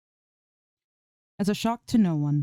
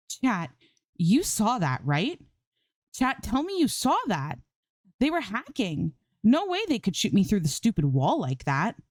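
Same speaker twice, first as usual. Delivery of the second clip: accusing; 6 semitones higher than usual. A narrator's reading. Streamer accusing her chat that the enemy cheated, indignant. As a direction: demand chat back you up. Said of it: "then accuses".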